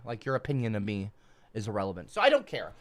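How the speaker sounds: usual speaking voice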